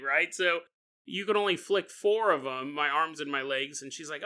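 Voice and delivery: normal talking voice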